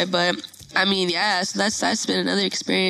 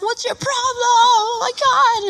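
high, wailing complaint, growing louder; mock near-tears protest; 4 dB louder, slower than usual. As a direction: imitate him about to cry. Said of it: "he was, like, about to cry".

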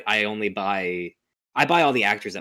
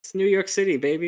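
sarcastic high; 11 semitones higher than usual. Pretend. Mocking movie logic.

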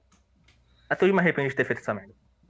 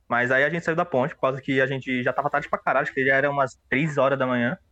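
normal talking voice

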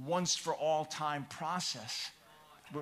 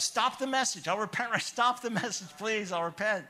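loud, high pleading to God with a laugh; retold begging; 5 semitones higher, 5 dB louder than usual. Pastor retells pleading with God in church, loud and high. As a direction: beg God to stop the sermon, half laughing. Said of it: "I was begging God".

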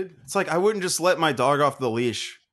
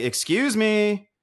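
higher, drawn-out mock politeness; pointed excuse-me; 7 semitones higher, slower than usual. Patron pushing past kid at the door, pointed politeness. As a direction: stretch it out pointedly.

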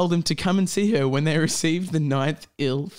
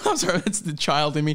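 ordinary talk, nothing marked

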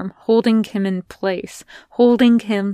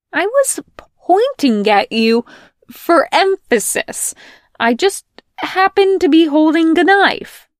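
loud, high protest; indignant bickering; 4 semitones higher, 6 dB louder than usual. Acted. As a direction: defend yourself in the argument. Quarrel about the knife.

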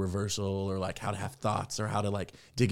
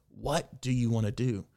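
ordinary talk, nothing marked

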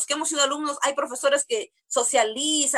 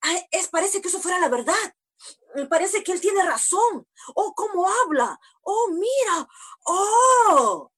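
high, gushing mock awe; imitating a swayed believer; 5 semitones higher than usual. Preacher mimicking a listener swept up by a false teacher, mock awe. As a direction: gush like someone carried away.

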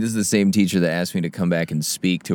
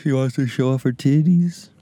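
mocking sing-song; 6 semitones higher than usual. Mocking sweatshirt wearer.

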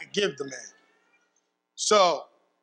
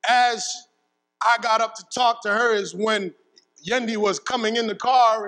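ordinary delivery

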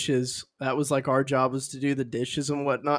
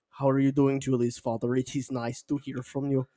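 put-on soft Russian-accented greeting; quiet, polite fake Russian; retold Russian impression; mock Russian accent; 2 semitones lower, 7 dB quieter than usual. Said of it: "I talked to him and I was like".

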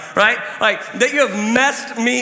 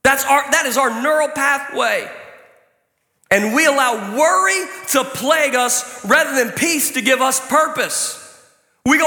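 ordinary delivery

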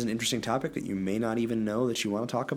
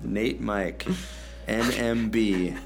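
usual speaking voice

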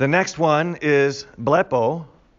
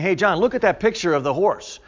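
higher, light attention-getter; casual calling out; 6 semitones higher, 3 dB louder than usual. Acted. Someone pointing John to a mere picture, casual calling out. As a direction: toss it out as a casual hey-look.

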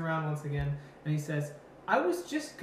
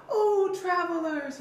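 put-on high, slow old man cry; old man calling out; 12 semitones higher, 4 dB louder, slower than usual. Old man hailing passing travelers, high and slow. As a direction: call out like a creaky old man.

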